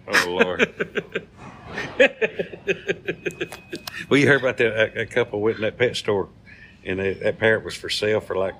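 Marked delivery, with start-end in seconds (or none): 0.8-4.0 real airy laugh at the joke; wheezing laugh; breathy giggle. Real, not acted. Laughing at own punchline.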